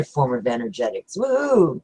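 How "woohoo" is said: high gleeful woohoo; excited whoop. Real, not acted. Host cheering as talk turns to magnetics, a gleeful high whoop. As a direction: cheer it out, thrilled.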